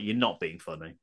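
low, soft put-down; dry retort. Real, not acted. Host answering the hated phrase, dry retort. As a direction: drop it down low and quiet.

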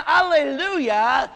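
high, loud, slow Alleluia rising; joyful alleluia. Pretend. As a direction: cheer it until you're purple. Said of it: "You would be saying".

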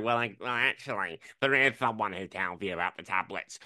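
lightly put-on nerdy well-actually voice; mock know-it-all listener. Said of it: "Someone's gonna be listening to this going".

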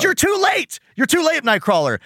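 high, dramatic cry of alarm; hammed-up cartoon despair; mock outburst. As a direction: cry it out high, then ease down. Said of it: "'cause he just says".

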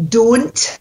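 "don't" with a pure long O; Scottish O sound. Shown as a demonstration. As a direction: say "don't" with one unglided O, lifted in pitch. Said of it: "we need to get that O sound".

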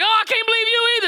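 high, fast mock disbelief; hammed-up shock. Preacher playing Adam seeing Eve, hammed-up shock. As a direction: squeal it like you just saw everything. Adam reacts to Eve's nakedness.

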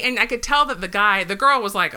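normal talking voice

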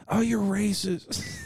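high, drawn-out imitation of yelling; mock yell. Girl calls out the passing guy, mock yell. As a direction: imitate an accusing yell without real volume. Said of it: "Like, yelling at him".

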